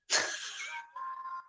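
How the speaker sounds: soft high-pitched giggle; real light laugh. Real, not acted. Amused chat about accents.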